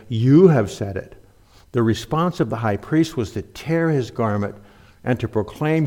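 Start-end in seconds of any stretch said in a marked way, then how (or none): 0.1-1.2 heavy pointed emphasis on YOU; stress on YOU. Shown as a demonstration. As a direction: hit YOU hard, then let the rest drop. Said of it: "Jesus was not saying".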